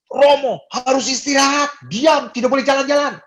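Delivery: high, loud scolding shout; doctor yelling. Retold or imitated. Doctor shouting at the priest to rest, loud scolding. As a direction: yell at the patient priest to stay in bed. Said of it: "dia itu teriak-teriak".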